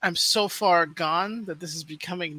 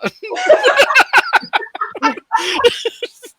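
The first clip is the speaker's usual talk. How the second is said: high burst of laughter; loud laughing fit; 12 dB louder than usual. Real, not acted. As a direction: crack up, loudly.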